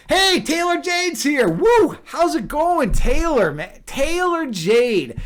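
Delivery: loud, high-pitched, drawn-out whooping welcome; excited greeting. Real, not acted. Streamer spotting friend Taylor join the chat, excited shout. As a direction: hail a friend with a big whoop.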